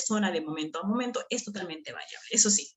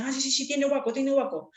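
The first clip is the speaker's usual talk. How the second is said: higher, eager thief muttering; thief spotting a phone; 5 semitones higher than usual. Retold or imitated. Teacher imitating a thief eyeing a phone. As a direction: point out the phone to your partner. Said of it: "te dicen".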